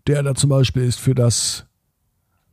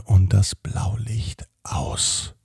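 whispering; 2 semitones lower, 4 dB quieter than usual. A narrator's reading. Sleepy bedtime story turn.

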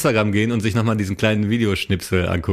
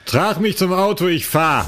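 put-on high, slow drunken boast; drunk yelling outside; 8 semitones higher, slower than usual. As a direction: slur it like a drunk teen. S1 acts drunk driver. (betrunken lallend und laut)

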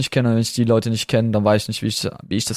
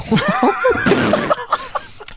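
long high laugh. Real, not acted. Laughs at the joke. (langes hohes Lachen)

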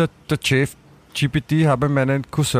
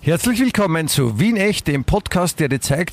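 Werbesprecher; 4 semitones higher than usual. Acted. Liest KI-Begrüßungstext vor.

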